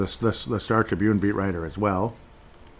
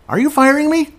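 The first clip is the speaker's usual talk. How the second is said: much higher, louder incredulous cry; shocked squeal; 9 dB louder than usual. Retold or imitated. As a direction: blurt it out in stunned disbelief. Acting out Boudreau firing scene.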